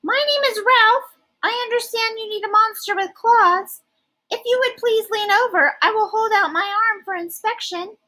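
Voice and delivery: very high, smooth and polite monster; high silky Ralph. Acted. Ralph offers his claws for inspection, very high and silky. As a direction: keep it high and silky, very proper. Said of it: "high, silky voice".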